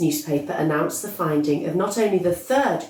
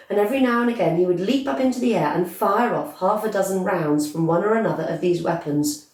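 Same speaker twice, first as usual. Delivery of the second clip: ordinary delivery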